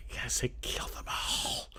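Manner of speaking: breathy whisper under the breath; a quiet unvoiced whisper. Real, not acted. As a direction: hush it to a murmur. Whispered aside.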